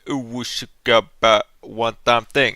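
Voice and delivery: put-on loud, mangled Swedish-British accent; hammed-up imitation of own Swedish accent, loud; exaggerated Swedish accent. Animator parodying own recorded line, exaggerated Swedish accent. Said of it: "Yeah, literally".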